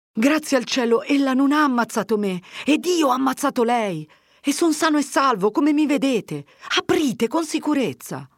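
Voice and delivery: high, eager exclamations; emphatic assurance. A narrator's reading. Fermo tells Agnese he survived the plague, emphatic. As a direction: insist fervently that all is safe.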